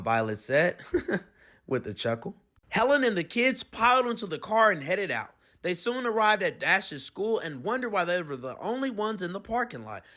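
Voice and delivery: plain reading voice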